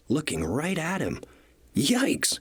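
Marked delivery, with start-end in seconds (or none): 1.7-2.4 high, sudden yikes; Percy startled by the shark, squeaky fright; startled yelp. A narrator's reading. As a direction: jump at the sight of a shark. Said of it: "he tooted loudly".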